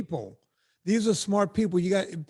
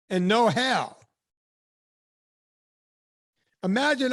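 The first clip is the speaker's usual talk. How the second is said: preacher voice; 3 semitones higher than usual. Pretend. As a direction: proclaim it like a sermon. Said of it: "preaching lyrics".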